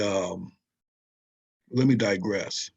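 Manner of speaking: ordinary talk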